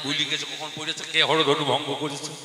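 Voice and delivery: usual speaking voice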